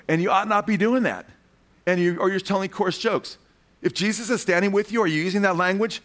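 fired-up rebuke. Real, not acted. Preacher rebuking cussing believers.

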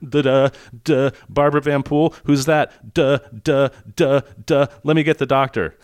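lightly voiced dopey nurse; mock dim nurse. Imitating suspicious nurse.

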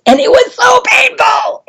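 high, strained cry of pain. Retold or imitated. Telling her childhood fan injury, a pained high cry. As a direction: relive the sting as you cry it out. Retelling thumb injury, screaming.

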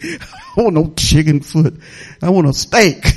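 low, laughing complaint. Real, not acted. Preacher joking to congregation about being sick of chicken, laughing. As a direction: chuckle through a playful protest.

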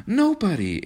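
high, hasty denial; quick answer. A narrator's reading. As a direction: blurt it in a high voice. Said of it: "answered Kismine quickly".

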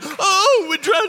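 high, loud, hammed-up cry of terror; panicked wail. Disciples cry out from the sinking boat, panicked wail. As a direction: wail like you're going under. Mimics disciples crying out in storm.

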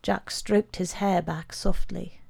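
usual speaking voice

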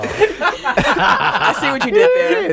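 slow and high, laughing through the line; real laughter, dragging it out. Real, not acted. Teasing a joke, laughing.

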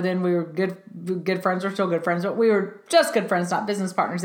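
usual speaking voice

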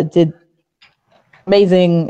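ordinary talk, nothing marked

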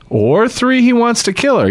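amused echo. Real, not acted. Echoing friend's joke.

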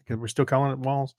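ordinary talk

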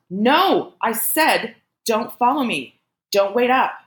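high, emphatic rejection; angry refusal. A narrator's reading. Woman angrily rejects being followed.

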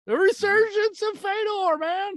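high, soaring fanboy proclamation; over-the-top hype. Pretend. Co-host hailing Fedor's comeback, excited and hammed-up.

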